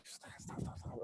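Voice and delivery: urgent whisper, stop stop. Shown as a demonstration. Shushing his screaming mom while answering the phone, whispered. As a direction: hush her, whispered and urgent. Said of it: "like this".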